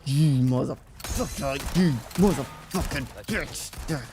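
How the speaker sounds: slow, drawn-out angry cursing; real frustrated swearing, stretched out; venting anger. Real, not acted. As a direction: let out anger at the game, word by word. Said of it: "vent out my anger".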